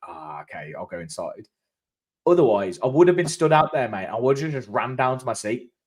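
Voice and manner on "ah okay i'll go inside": resigned mutter. Retold or imitated. Retold giving up, going inside.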